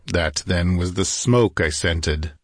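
ordinary delivery, nothing marked

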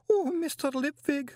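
shrill-pitched cry of a distraught woman; yelled wail. A narrator's reading. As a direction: cry out very high, in tears. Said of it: "she yelled".

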